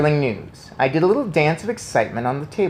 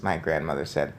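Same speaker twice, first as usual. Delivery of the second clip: ordinary delivery, nothing marked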